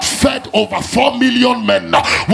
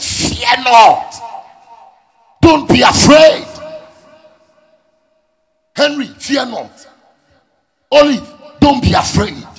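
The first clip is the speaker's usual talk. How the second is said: proclaiming God's command. Acted. Preacher voicing God's command.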